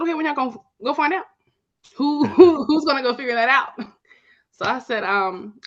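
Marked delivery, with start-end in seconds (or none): 0.0-3.7 excited urging. Retold or imitated. Retold pushing sorors to act.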